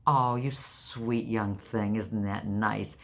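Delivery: put-on to the young clerk, slow sugary sarcasm; sarcastic sweetness. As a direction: purr it slowly and dry. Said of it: "And I'm like".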